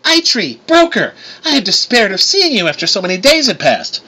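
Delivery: high-pitched, effusive hello; delighted greeting. A narrator's reading. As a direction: greet long-missed friends with delight. Ginny gleefully greets the dwarves.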